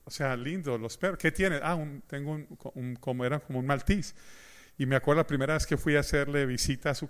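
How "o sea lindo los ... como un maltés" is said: hesitant quiet quote. Retold or imitated. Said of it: "le dije".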